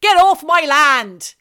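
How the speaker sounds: loud, drawn-out West Country bellow; West Country farmer. Acted. Host playing farmer shooing trespassers, shouted West Country accent. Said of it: "Please give us some West Country".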